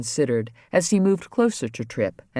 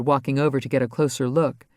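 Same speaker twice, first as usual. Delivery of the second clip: ordinary delivery, nothing marked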